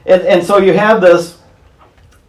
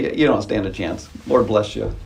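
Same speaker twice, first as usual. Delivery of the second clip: sarcastic; 8 semitones lower, 8 dB quieter than usual. Retold or imitated. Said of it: "Just kinda sarcastic".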